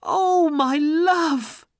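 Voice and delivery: slow, very high, loud cry; long, high swooning shout. A narrator's reading. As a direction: call out overjoyed to your love. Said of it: "she cried".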